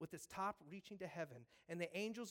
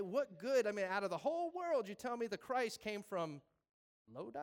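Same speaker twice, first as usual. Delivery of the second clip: loud mock disbelief; 2 semitones higher, 7 dB louder than usual. Preacher mocks the doubt loudly.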